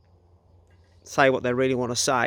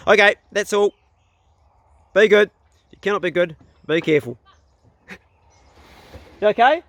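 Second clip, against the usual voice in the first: calling out; 6 semitones higher, 6 dB louder than usual. Real, not acted. Calling out to the boy.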